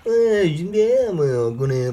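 high then lazy French-ish drawl; mock French accent. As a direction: fake French gibberish, hammed-up. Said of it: "we just kind of do an impression like that".

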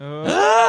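loud high gasp of alarm; real startled gasp as it wobbles. Real, not acted. Tower collapses under book.

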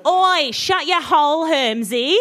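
slow, broad Australian telling-off; Australian accent. Acted. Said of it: "said a very Australian Ron".

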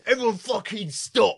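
imitated angry, high-pitched order; Ray Winston shouting. Actor halting the student's scene, imitated angry order. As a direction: raise the pitch to halt everyone. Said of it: "he was talking like that".

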